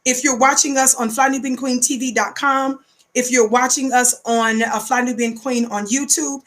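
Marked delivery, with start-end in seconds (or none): none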